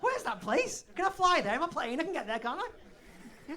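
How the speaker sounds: high, loud excited questions; eager Alex. Retold or imitated. Alex booking a flight under the table, high and eager. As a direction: gush like you're booking a flight. Voicing eager audience member.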